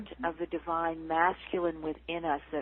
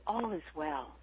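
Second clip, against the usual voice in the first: ordinary talk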